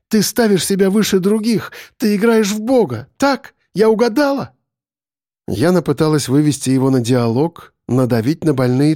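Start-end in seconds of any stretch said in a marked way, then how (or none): 0.1-4.5 high, rising challenge; pressing accusation. A narrator's reading. Captive presses on captor's sore spots, challenging. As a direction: push harder with each phrase, pitch climbing.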